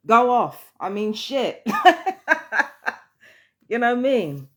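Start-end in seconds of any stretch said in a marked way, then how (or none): 1.7-2.9 short burst of high, loud laughter; amused high cackle. Real, not acted. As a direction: laugh out loud at your own remark. Laughs at own remark.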